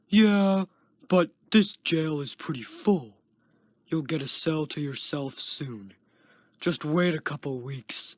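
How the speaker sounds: loud start, easing off; uncaring yell. A narrator's reading. Guard walking away dismisses Kenton, uncaring yell. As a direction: toss it back over your shoulder. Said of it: "uncaringly yelled".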